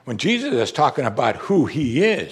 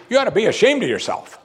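high, emphatic telling-off; mock scolding; 8 semitones higher, 3 dB louder than usual. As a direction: imitate someone shaming a sinner. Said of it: "he never talks to sinners like that".

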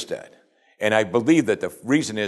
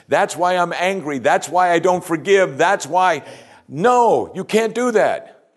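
much higher, urgent sermon delivery; impassioned preaching; 8 semitones higher than usual. Real, not acted. Preacher urging congregation that it's only Christ, impassioned. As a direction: drive each point home with rising force.

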